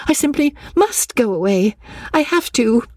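high, urgent insistence; worked-up, high-pitched plea; growing excitement. A narrator's reading. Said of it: "in growing excitement".

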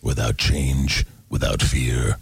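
low, slow trailer narrator; deep, drawn-out trailer voice-over; movie trailer voice. Shown as a demonstration. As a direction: intone it like an ominous film trailer. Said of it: "You wanna hear a trailer voice".

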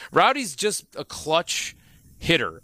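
slow build with a pause, then "hitter" landed firmly with a falling close; stress on hitter. Real, not acted. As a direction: lean on "clutch", pause, then hit "hitter" hard. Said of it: "I emphasize the word hitter".

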